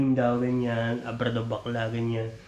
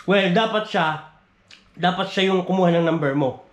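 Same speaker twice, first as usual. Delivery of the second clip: normal talking voice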